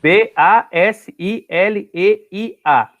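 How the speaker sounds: each letter named slowly, one by one; slow letter-by-letter spelling for students. Shown as a demonstration. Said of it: "Então, Basileia".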